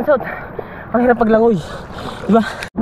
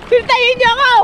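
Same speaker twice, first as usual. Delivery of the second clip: excited high-pitched hollering; breathless, high, carrying shouts; urgent calling out; 5 semitones higher than usual. Real, not acted. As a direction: call across the water for help. Calling companions for the tub.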